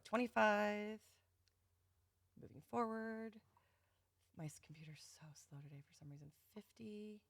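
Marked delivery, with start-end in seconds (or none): 2.4-7.2 much softer, fast under breath; muttering aside. Real, not acted. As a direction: mutter it to yourself. Muttering while counting.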